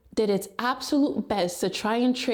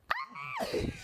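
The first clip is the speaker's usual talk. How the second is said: a high, shrieking burst of laughter; 10 dB quieter than usual. Real, not acted. Announcing her app to viewers, a high shrieking laugh. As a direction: let a high laugh escape. Cracking up on camera.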